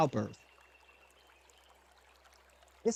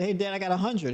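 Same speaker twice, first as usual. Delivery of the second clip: put-on proud kid; 6 semitones higher, 4 dB louder than usual. Kid proudly reports perfect score.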